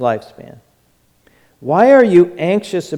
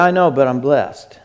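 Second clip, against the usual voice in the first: usual speaking voice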